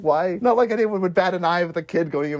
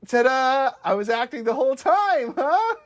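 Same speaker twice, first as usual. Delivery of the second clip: higher, showy gloating reveal; mock Dee ta-da; 9 semitones higher than usual. Retelling Dee to the cohost, higher and smug. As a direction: sell the trick with a smug ta-da.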